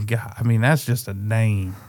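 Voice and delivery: ordinary delivery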